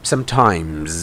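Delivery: "sometimes" ending on a drawn-out buzzing Z; buzzing final S. Shown as a demonstration. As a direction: let the S of "sometimes" vibrate. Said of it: "จะเป็นเสียงสั่นๆ".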